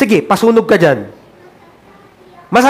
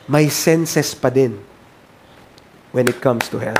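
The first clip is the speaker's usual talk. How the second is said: hushed sermon; 9 semitones lower, 7 dB quieter than usual. Acted. Sinks to near whisper.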